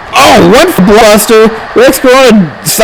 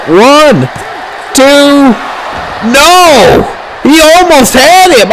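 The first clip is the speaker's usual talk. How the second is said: excited; 6 semitones higher, much slower than usual. Real, not acted. Near fall call.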